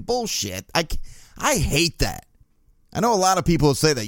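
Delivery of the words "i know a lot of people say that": ordinary delivery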